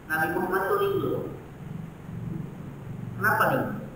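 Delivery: ordinary delivery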